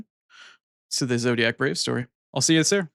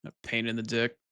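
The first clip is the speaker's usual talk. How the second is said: muttered under breath; 2 semitones lower, 10 dB quieter than usual. Real, not acted. Streamer grumbling to himself about Windows, softer mutter. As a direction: mutter it to yourself, much softer.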